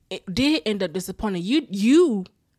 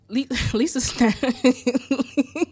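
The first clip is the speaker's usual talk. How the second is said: breaking up laughing mid-word; real laughter; 4 semitones higher, 4 dB louder, much slower than usual. Real, not acted. Vlogger retelling how Lisa snatched the bowl, laughing. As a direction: try to speak and collapse into laughs.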